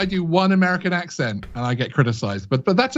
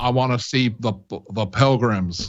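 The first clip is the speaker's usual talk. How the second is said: lightly done John Wayne impression, lower; 6 semitones lower, 4 dB quieter than usual. [name] picking pilgrims for the host, attempted John Wayne. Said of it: "is that a good impression".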